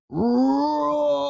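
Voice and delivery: high, loud roaring cry; lion roar. A narrator's reading. Young lion roaring to scare off hyenas. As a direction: let out a huge lion roar. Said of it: "Then Kion roars".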